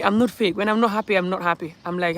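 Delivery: ordinary delivery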